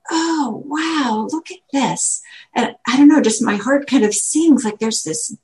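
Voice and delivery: normal talking voice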